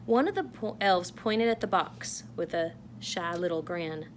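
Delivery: plain reading voice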